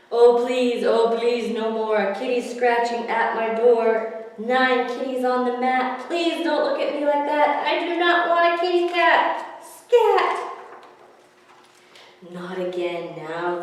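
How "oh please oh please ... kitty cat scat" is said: pleading. Acted. Man pleading, rising to a shout.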